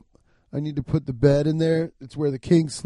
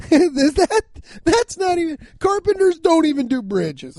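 laughing; 13 semitones higher, 7 dB louder than usual. Real, not acted. Laughing at his own wrong adage.

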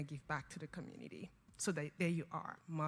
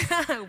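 laugh; 13 semitones higher, 14 dB louder than usual. Real, not acted. Laughs at host's remark.

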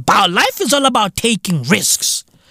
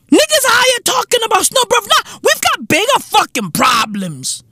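high, fast, incredulous rush; exasperated outburst; 11 semitones higher than usual. Real, not acted. Comic ranting at people online, high fast disbelief. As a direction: blurt it out high and quick in disbelief. Exasperated rant about snow talk.

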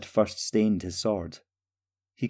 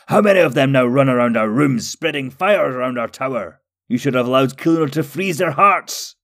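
loud, high-pitched scolding; angry yell; 4 semitones higher, 5 dB louder than usual. A narrator's reading. Nalur berates Jihur over fire in the tower, furious yelling. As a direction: shout accusingly, at the end of patience. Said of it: "Nalur Blackcloak yelled".

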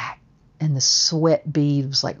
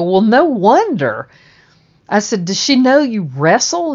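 incredulous; 6 semitones higher, 6 dB louder than usual. Retold or imitated. Laughing incredulous reply.

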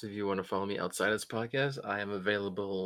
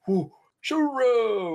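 much higher, louder, slow squeal of delight; gleeful Philip; 12 semitones higher, 8 dB louder, slower than usual. Retold or imitated. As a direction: gush over his partner like a delighted fan. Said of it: "And then Philip's like".

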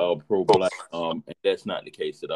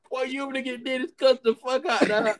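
laughing through a quote. Retold or imitated. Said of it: "I was like".

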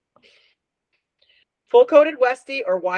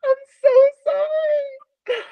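high, slow apology through laughter; laughing apology; slower than usual. Real, not acted. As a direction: gasp out sorry while cracking up. Laughing apology after gross face.

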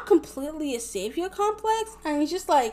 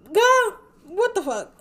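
slow, high, drawn-out disbelief; sassy disbelief; 7 semitones higher, slower than usual. Retold or imitated. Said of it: "She was like".

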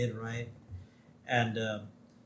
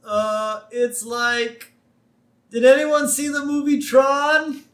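high, held calling voice; Homer; 11 semitones higher, 3 dB louder, slower than usual. Retold or imitated. Host as Homer answering the doctor, calling back high. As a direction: call back searchingly on a high monotone.

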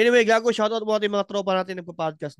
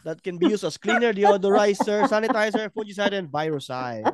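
ad read; 4 semitones higher than usual. Acted. Reads sponsor ad.

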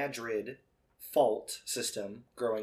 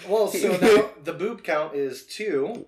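laughing; 6 semitones higher, 10 dB louder, slower than usual. Real, not acted. Joking through laughter.

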